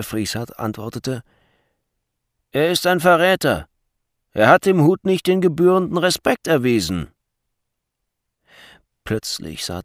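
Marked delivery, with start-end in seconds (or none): 2.5-7.1 loud, high denunciation; forceful official accusation; accusing guard. A narrator's reading. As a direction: denounce a traitor in a raised voice.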